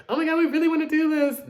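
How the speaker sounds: high, fast, loud mock eager; mock eager girls. Mocking the girls as eager to do the concept, high and fast. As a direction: ham up eager idols. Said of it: "be like".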